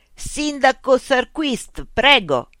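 loud, high robot policeman hail; calling out. A narrator's reading. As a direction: call out slowly, drawing out each word.

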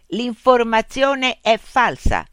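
slow, loud mechanical reply; robot policeman. A narrator's reading. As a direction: answer like a machine, high and measured. Robot policeman relays official answer.

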